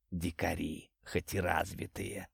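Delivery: very soft, low, slow whisper; whispering snake. A narrator's reading. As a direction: whisper it low, drawing out the words. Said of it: "прошептал он". (медленный низкий шёпот)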